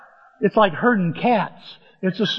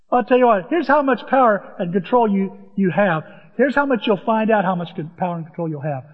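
usual speaking voice

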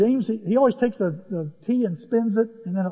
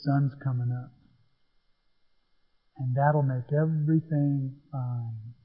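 calm friend; 5 semitones lower, 4 dB quieter, slower than usual. Retold or imitated. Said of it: "He goes".

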